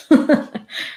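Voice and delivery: short, breathy laugh; brief amused chuckle. Real, not acted. Host brushing off an off-topic chat question, amused chuckle.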